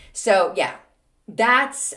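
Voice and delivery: normal talking voice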